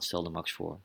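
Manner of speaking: plain reading voice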